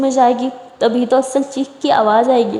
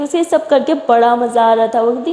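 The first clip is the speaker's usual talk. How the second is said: ordinary delivery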